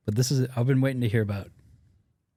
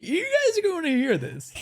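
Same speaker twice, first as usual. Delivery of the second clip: laughing. Pretend. Joins the radio bit, laughing.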